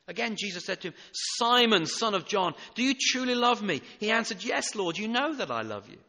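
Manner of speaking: loud preaching voice, ringing out each line; forceful scripture reading. Acted. Preacher reading Jesus and Peter aloud, loud and ringing. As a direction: proclaim the dialogue to the whole church.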